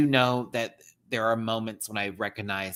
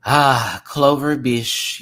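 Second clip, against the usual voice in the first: ordinary delivery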